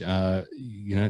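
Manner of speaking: usual speaking voice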